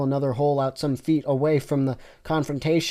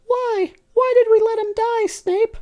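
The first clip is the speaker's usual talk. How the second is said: very high squeaky mock lament; hammed-up grief; mock sad Lala; 4 dB louder than usual. Lala lamenting to Snape, very high. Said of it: "sad and crying".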